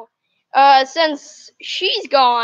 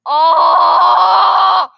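disgusted groan; 3 semitones higher, 6 dB louder than usual. Real, not acted. Bean Boozled reaction.

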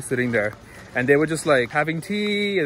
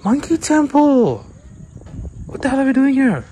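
excited shout; 9 semitones higher, 4 dB louder than usual. Real, not acted. Excited call for the temple.

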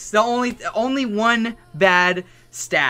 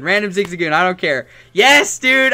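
normal talking voice

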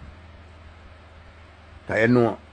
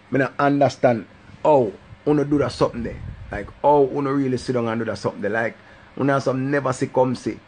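usual speaking voice

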